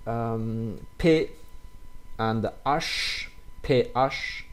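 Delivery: ordinary talk, nothing marked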